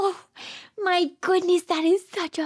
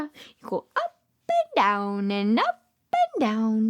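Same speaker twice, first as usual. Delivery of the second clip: sing-song swoop up and down, drawn out; seesaw voice; 7 semitones lower, slower than usual. Shown as a demonstration. Showing little kids how a seesaw moves, swooping pitch.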